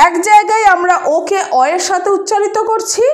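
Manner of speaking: normal talking voice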